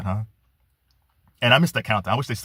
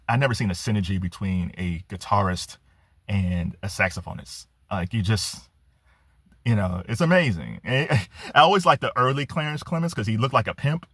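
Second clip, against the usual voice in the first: ordinary talk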